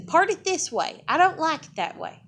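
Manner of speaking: high, loud bossy relative arguing; bossy mom. Retold or imitated. Mother bossing her little daughter about her hair, mock high and loud. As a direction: mimic the mom ordering the hair parted. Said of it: "they, uh".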